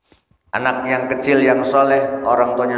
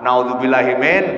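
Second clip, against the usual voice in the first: ordinary talk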